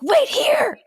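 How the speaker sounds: high loud shriek; screaming. Retold or imitated. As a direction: scream the order in panic. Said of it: "I was Sally screaming".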